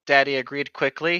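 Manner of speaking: ordinary delivery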